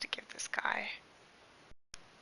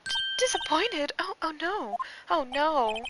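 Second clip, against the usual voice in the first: high dismayed voice; 7 semitones higher than usual. Acted.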